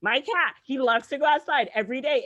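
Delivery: ordinary delivery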